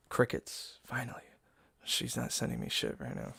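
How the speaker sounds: hushed, breathy whisper of relief. Real, not acted. Streamer whispering relief to chat that she stopped. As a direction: drop to a whisper as the messages stop. Quiet relief after messages stop.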